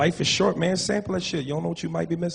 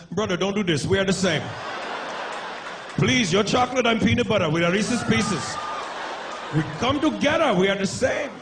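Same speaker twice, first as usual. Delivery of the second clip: high mock Indian accent, begging; hammed-up panicky Indian accent; pleading Indian accent; 5 semitones higher than usual. Indian man pleads at gunpoint.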